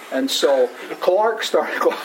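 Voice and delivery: ordinary talk, nothing marked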